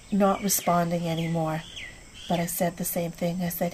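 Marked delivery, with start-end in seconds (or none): none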